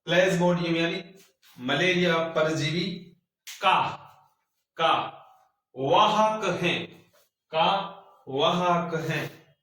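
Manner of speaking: slow, word-by-word dictation; dictating. Shown as a demonstration. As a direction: spell it out slowly for note-taking. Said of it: "मैं नीचे लिख रहा हूं".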